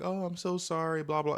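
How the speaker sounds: high, drawn-out whiny imitation; mock tearful apology. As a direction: imitate her wailing an apology through tears. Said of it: "she bursts out in tears".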